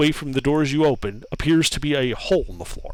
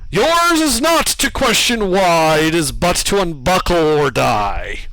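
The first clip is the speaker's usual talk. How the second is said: booming statue voice; 2 semitones higher, 4 dB louder than usual. Acted. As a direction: lord it over them, booming.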